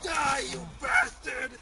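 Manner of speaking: furious yell; angry battle cry. Acted. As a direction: scream it in rage.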